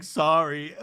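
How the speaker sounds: hammed-up tearful apology; mock tearful. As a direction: imitate someone about to break down. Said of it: "And starts sobbing".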